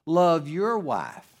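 slow, your leaned on; stress on YOUR. Shown as a demonstration. Pastor tells husbands whose wife to love, stressing your. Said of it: "emphasize that other word again".

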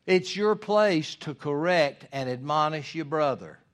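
ordinary delivery

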